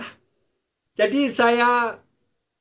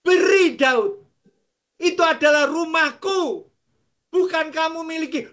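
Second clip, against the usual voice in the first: a hint of a stern higher commanding voice; voice of God; 7 semitones higher, 3 dB louder than usual. God telling Daud the building is His, stern. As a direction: speak as God, firm and final. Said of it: "Tuhan bicara di telingaku".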